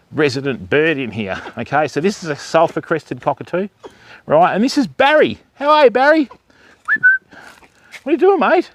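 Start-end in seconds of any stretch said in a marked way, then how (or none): 4.5-8.7 pet talk. Real, not acted. Talking to Barry the cockatoo.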